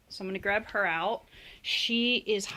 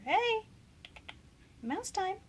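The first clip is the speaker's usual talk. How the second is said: bright pet-calling voice; high sing-song call; 11 semitones higher, 4 dB quieter, slower than usual. Real, not acted. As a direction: call the snake's attention. Calling the snake.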